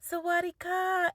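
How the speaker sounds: high, lilting imitation of a greeting; acted-out Thai greeting in a high voice; polite returned greeting. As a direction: imitate a polite hello, pitched well up. Re-enacts woman's greeting in return.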